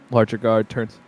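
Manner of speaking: ordinary talk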